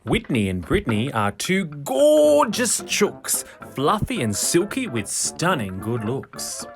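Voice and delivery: ordinary delivery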